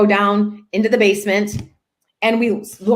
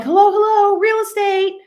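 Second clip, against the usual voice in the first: a realtor calling out hello; 10 semitones higher than usual. Retold or imitated. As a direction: call out to find the owner. Calling into an open house.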